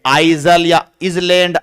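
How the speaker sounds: "आइजल", "इसलैंड" with the S wrongly sounded; mispronounced S. Shown as a demonstration. Teacher showing students how not to read isle, S wrongly sounded. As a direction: say "आइजल" and "इसलैंड", voicing the silent letter. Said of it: "ये एस का उच्चारण नहीं होता है".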